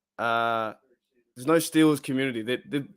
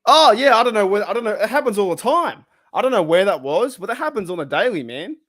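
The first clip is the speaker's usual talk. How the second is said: raised, louder and higher, fed-up rant; ranting dude; 7 semitones higher, 6 dB louder than usual. Retold or imitated. Fan retelling an annoyed online rant, raised and louder. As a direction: blow off steam like an irritated fan. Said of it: "the dude was saying".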